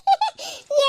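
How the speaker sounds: short high giggle; gloating giggle. Acted. Menaka gloating over grabbed biryani, high giggle. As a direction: giggle in triumph.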